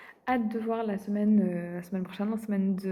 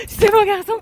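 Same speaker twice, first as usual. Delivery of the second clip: even higher squeal of praise; excited dog talk; 11 semitones higher, 14 dB louder than usual. Real, not acted. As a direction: gush with more pitch.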